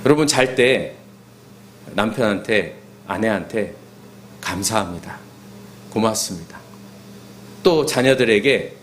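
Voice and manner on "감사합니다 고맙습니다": softly, low and sincere; quiet bedtime thank-you; tender thanks. Shown as a demonstration. Spouse to spouse at bedtime, tenderly thankful.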